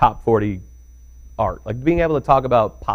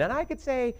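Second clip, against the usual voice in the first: sing-song teacher impression; sweet teacher voice; 13 semitones higher, 3 dB quieter than usual. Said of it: "she started by saying".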